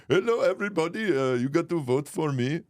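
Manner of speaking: high-pitched, put-on Indian accent; Kamala's mock Indian accent. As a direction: campaign greeting in a caricatured Indian accent. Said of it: "Why not the Indian voice?".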